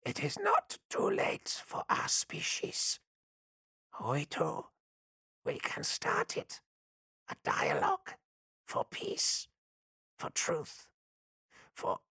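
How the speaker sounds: high, slow, broken whisper; plead, voice failing; hoarse and broken. Acted. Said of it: "its voice hoarse and broken".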